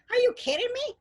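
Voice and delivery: put-on very high squeaky street-kid voice; urchin voice. A narrator's reading. Dirty urchin answering old miser, high squeaky voice. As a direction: play a cheeky urchin.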